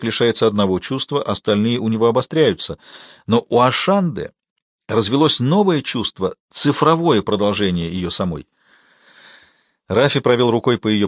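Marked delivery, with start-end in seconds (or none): none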